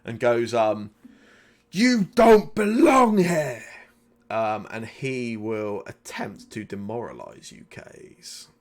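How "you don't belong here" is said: high, loud, drawn-out roar; full-throated battle shout; angry bellow. Acted. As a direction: bellow it slowly at an opponent, stretching the words. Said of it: "he bellows and shouts out".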